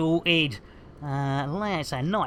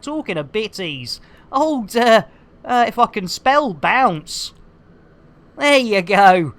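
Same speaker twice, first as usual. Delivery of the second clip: ordinary talk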